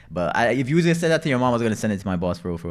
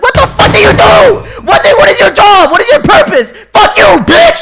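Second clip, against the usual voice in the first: high-pitched, full-volume screaming rant; furious retort; 15 dB louder than usual. Retold or imitated. Son acts out demanding mom's purpose, furious screaming. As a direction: scream each question in exasperated fury. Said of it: "And I'm like".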